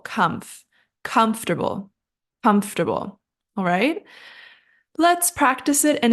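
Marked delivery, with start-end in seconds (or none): 0.0-3.2 COMFORTABLE stressed on COMF; first-syllable stress. Shown as a demonstration. Teacher shows the stress, COMFORTABLE with COMF strongest. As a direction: punch COMF, then let "-ter-bul" fall in COMFORTABLE. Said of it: "stress is on our first syllable".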